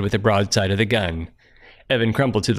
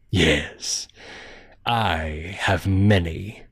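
low looming threat; 3 semitones lower, 3 dB quieter, much slower than usual. Acted. Stranger's dark reply.

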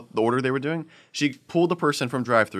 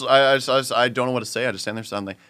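ordinary delivery, nothing marked

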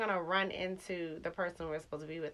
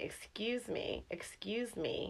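imitating a pushy stranger; 2 semitones higher, 7 dB quieter, much slower than usual. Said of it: "He keeps saying".

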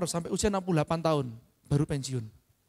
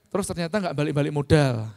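ordinary delivery

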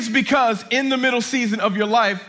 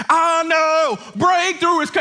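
high comic outcry; mock alarm; 6 semitones higher, slower than usual. Preacher joking about clothes coming off, mock alarm. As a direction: act out a sudden panicked yelp.

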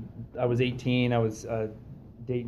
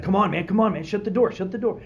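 high, louder frantic pleading; panicked urging; 9 semitones higher, 5 dB louder than usual. Retold or imitated. Friend begging the elevator door to shut, high panicky.

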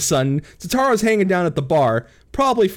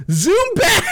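high excited call; 12 semitones higher, 3 dB louder than usual. Pretend. Rushing the story ahead.

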